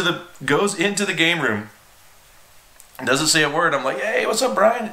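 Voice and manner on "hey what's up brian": high, breezy casual greeting; oblivious hello. Retold or imitated. Kid greeting his angry boss, oblivious. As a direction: greet him clueless and chipper.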